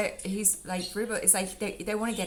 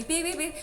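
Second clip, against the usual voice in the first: high mock beeping like a car horn; imitated car beeps; 8 semitones higher than usual. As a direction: toss off the beeps playfully.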